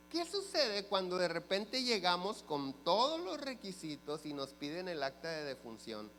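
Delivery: ordinary delivery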